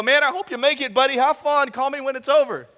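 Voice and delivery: high, loud, falsely cheerful well-wishing; sarcastic friend waving you into danger; hammed-up breezy farewell; mock cheery send-off. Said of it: "they look at it and go".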